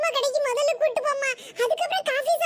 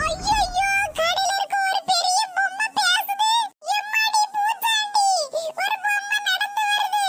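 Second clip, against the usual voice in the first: high-pitched alarmed cry; frightened child; 5 semitones higher, slower than usual. Acted. Children see a big doll talk and walk, frightened cries. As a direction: panic at a walking monster. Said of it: "பிள்ளைங்களா, பயப்படாதீங்க".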